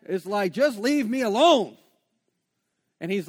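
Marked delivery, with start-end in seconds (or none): 0.5-1.8 a loud, fed-up plea to be left alone; louder exasperated plea. Retold or imitated. Preacher voicing his plea to the Holy Spirit, loud and exasperated.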